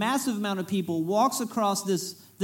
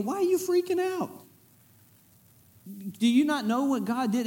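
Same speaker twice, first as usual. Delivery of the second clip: much higher, incredulous; exasperated disbelief; 6 semitones higher than usual. Retold or imitated. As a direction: ask it as if you can't believe them. Imagined leader exasperated at complainers.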